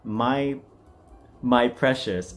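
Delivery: ordinary talk, nothing marked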